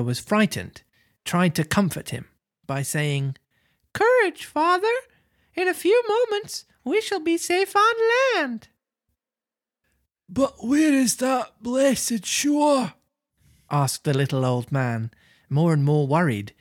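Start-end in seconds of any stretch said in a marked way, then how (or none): 3.9-8.7 put-on joyful wooden puppet boy; jerky creaky wooden puppet. Reads Pinocchio's lines. 10.3-12.9 put-on high, slow, fretful old voice; anxious little old man; worried old man. Reads Geppetto's lines.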